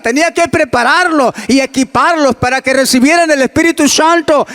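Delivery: preaching at full force. Acted.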